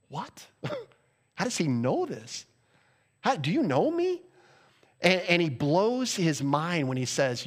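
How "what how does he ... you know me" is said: a gasp of wonder, then higher on know me; stunned disbelief. Acted. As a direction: act amazed someone sees right through you. Nathanael's stunned reaction, acted.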